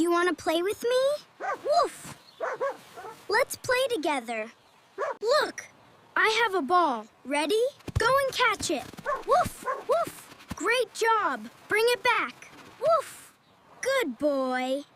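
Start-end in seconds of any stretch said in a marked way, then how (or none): 1.6-2.2 two high little woofs; puppy woof. Acted. Puppy answers a child calling it over, high woofs. 9.3-10.3 two high little woofs; puppy woof. Acted. Puppy answers a child calling it over, high woofs. 12.8-13.3 two high little woofs; puppy woof. Acted. Puppy answers a child calling it over, high woofs. Puppy brings the ball back.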